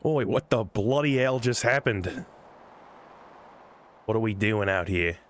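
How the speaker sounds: lightly voiced scrappy Aussie girl, startled; a hint of a surprised young Aussie adventurer; Kid voice. Streamer voicing Kid waking somewhere strange, startled. Reads Kid's dialogue in character.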